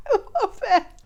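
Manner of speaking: high, delighted words through laughter; real amused laughter in the voice; laughing. Real, not acted. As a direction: giggle it out, tickled by the joke. Delighted by a film gag.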